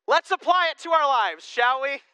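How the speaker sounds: loud, high mock-preacher delivery; mocking put-on. Preacher teasing as he moves to application, mocking. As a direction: make fun of it, high and loud. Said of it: "I love making fun of people".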